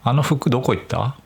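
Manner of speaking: 「あの服どこ行った？」 with Tokyo accent on 服; same question the Tokyo way; 東京アクセントで「あの服どこ行った？」. Shown as a demonstration. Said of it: "東京だと".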